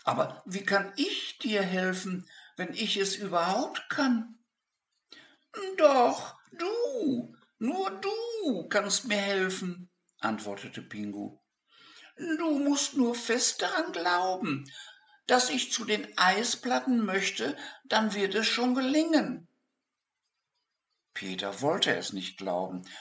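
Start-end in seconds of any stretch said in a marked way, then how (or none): 0.0-4.4 put-on high child's voice, gently puzzled; high boy voice, wondering; caring boy. Narrator voices Peter. 5.8-19.5 high squeaky Pingu voice, hopeful; penguin voice. Acted.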